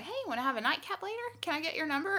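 high, casual come-on; imitating flirty bartender. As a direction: lightly voice a bartender angling for a date. Retold bartender's flirty pickup.

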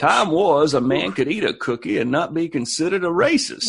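put-on Mark Twain accent. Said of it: "use the accent".

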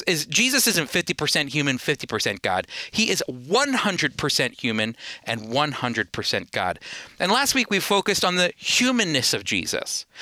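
normal talking voice

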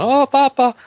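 put-on high, loud whimpering kid crying for Papa; hammed-up terrified little boy on lava; scared child voice. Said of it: "hopping around the furniture going".

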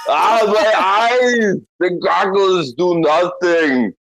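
drawn-out, high-pitched Austrian wail; hammed-up action-hero agony, very slow; Arnie. Shown as a demonstration. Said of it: "the Arnie voice".

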